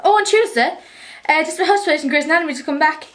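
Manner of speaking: usual speaking voice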